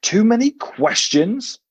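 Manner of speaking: slow, high incredulous rise on many; disbelieving huff. A narrator's reading. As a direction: scoff the question back in disbelief. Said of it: "with a disbelieving huff".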